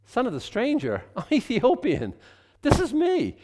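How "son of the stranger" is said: son of the stranger? rereads it as a question, stranger falling; catching a phrase that hits home; stunned rereading of son of the stranger; surprised double take. Real, not acted.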